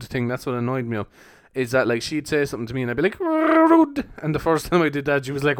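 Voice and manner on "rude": "rude" very high, loud, with a long rolled r; mock-offended "rude". Teasing his girlfriend, "rude" squealed high and drawn out. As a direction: trill and stretch "rude" in a high comic voice.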